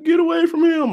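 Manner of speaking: high-pitched retold cry of alarm; mock scream. Onlookers crying out as lions drag him off, mock scream. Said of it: "people screaming".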